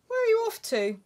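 an owl calling to a bear flying past. Acted. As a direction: call out brightly like a curious owl.

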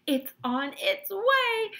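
high, loud, drawn-out call; excited cry. A narrator's reading. Wonka as the chocolate flies off, excited cry. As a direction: stretch it out in a high triumphant cry. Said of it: "cried Mister Wonka".

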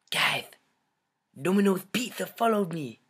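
high, hammed-up fangirl squeal. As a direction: gush it like a starstruck fan. Gushes as a fan.

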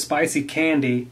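normal talking voice

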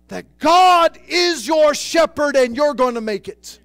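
loud, high shouted sermon; preacher shouting triumph, high and loud; full-force preaching. Acted. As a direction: shout the victory to the church.

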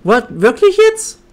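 high, loud disbelieving yelp; surprised outburst. Real, not acted. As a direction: blurt it out in shock. Shocked outburst at game.

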